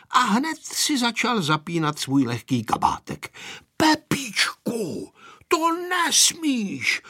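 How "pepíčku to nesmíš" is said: put-on high, drawn-out child's warning; slow high-pitched scolding threat; threatening warning. A narrator's reading. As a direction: warn him off, stretching every word. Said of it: "hrozil mu Vojta".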